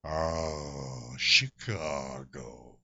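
slow, low, hammy villain's lament, a Hamlet air; pompous fish villain moaning over his city; Limburger catchphrase. Retold or imitated. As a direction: stretch out Chicago like a tragic ham actor. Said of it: "I began to do stuff like".

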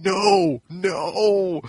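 high, drawn-out mock crowd wail; crowd crying no. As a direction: imitate a dismayed crowd, pitched high. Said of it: "the crowd's going".

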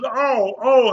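mock the injured woman's moaning, rising in pain. Retelling how the hurt friend moaned on the bumpy boat, high pained moans.

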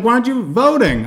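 high mock nag; imitating girlfriend. Courtney nagging him about voting, high voice. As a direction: push the question up high as her. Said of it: "she's like".

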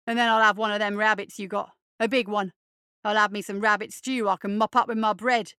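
put-on loud, brash street kid; brash urchin bluster; cocky boy. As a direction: demand it bold and loud. Eddie demands a rabbit.

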